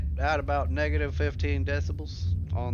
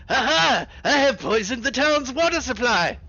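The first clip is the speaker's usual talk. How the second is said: gloating villain, raised and loud; evil genius; 11 semitones higher, 8 dB louder than usual. Retold or imitated. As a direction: crow it like a cartoon villain. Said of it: "an evil genius laugh".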